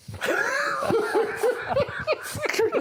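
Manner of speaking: high delighted laughing shout; shrieking laugh. Real, not acted. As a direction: burst out in a high joyful laugh. Scoring his first great goal.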